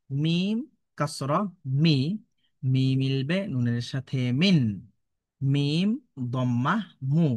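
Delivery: slow, drawn-out syllables with rising stretched endings; spelling drill recitation. Shown as a demonstration. Teacher spells joined syllables for learners to follow, slow drill recitation. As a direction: chant each spelling step deliberately.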